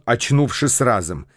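ordinary delivery

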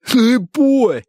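high, loud shout. Acted. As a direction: call out loudly, pitched high. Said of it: "крикнул он ей". (громкий выкрик)

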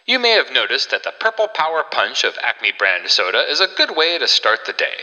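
fast, upbeat pitchman patter; chipper 1950s ad. Acted. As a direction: rattle off the sales pitch. Host performs retro soda ad.

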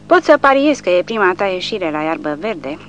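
usual speaking voice

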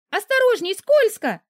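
очень высокий громкий испуганный писк; пискнуть в испуге предупреждая. Acted. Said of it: "успела пискнуть я". (героиня пищит великану на обледеневшей ступеньке)

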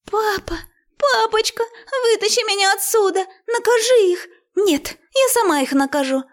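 put-on very high, tearful girlish wail; pleading daughter. A narrator's reading. Trapped girl begs father for rescue, shrilly pleading. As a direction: whine and demand in a high child's voice.

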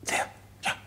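frightened whisper; hushed and fearful, naming the man below. Acted. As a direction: hiss it, pointing down, afraid to be heard. Pointing out the murderer secretly.

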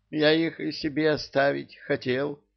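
mildly plaintive high voice. A narrator's reading. King confessing he wanted to keep the children, plaintive. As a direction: plead a little pitifully, high-pitched. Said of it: "жалобно шмыгнул носом".

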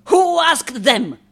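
loud, high, stretched question; slow, loud indignation; indignant demand. Acted. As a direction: demand it in outrage. Resents film crew.

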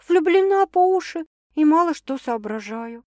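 put-on lovestruck girl. A narrator's reading. Sasha sighing, lovestruck.

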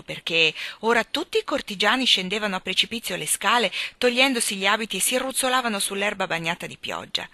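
ordinary delivery

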